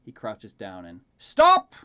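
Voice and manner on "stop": high, loud sudden shout; shouting stop. Acted. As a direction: yell to halt him. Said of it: "Lucas yells".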